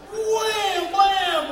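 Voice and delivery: slow, loud shouted wham and blam; hammed-up slow crash shout; crash sound effects. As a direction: paint the crash big and loud. Retelling the trip and crash.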